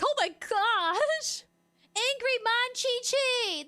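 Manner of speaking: high, loud and drawn-out, teasing; mock exasperated outcry. Streamer mocking her raging family, high loud drawn-out mock outcry. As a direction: stretch it out, mocking their tantrum.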